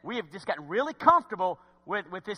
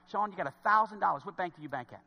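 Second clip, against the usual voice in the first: ordinary talk, nothing marked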